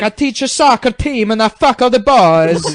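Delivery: put-on loud, high Italian-sounding accent; mock foreign accent. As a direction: boast in a hammed-up European accent. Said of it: "It's like an Italian accent".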